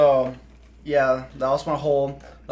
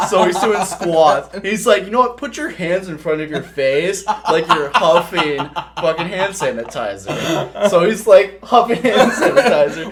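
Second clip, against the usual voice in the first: loud, high, laughing narration; laughing through it; 5 semitones higher, 9 dB louder than usual. Real, not acted. Storyteller laughing through the squats story, loud and high. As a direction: tell it while cracking up.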